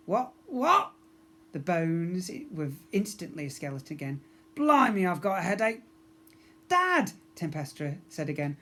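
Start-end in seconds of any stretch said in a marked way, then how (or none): 0.1-0.9 confused skeleton. Acted. 4.5-5.8 groggy captain. Acted. Skeleton captain. 6.7-7.1 daughter. Acted. Daughter to father.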